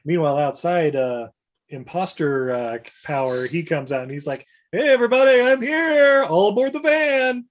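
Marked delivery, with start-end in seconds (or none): 4.7-7.4 high, loud hail to a crowd; hammed-up rallying call. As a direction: holler a mock all-aboard. Retold imposter calls to crowd.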